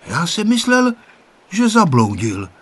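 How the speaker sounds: puzzled, starting high; mildly astonished; wondering. A narrator's reading. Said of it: "divil se Honzík".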